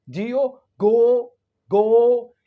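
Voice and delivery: गो with a long held o vowel. Shown as a demonstration. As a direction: draw out गो, a long oh. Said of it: "ये longer sound होता है".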